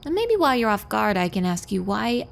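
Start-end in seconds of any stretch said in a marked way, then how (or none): none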